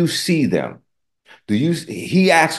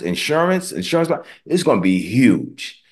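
usual speaking voice

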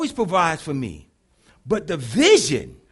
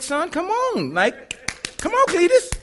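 gentle coaxing God; 11 semitones higher, slower than usual. Retold or imitated. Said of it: "he wasn't yelling at me, but he was like".

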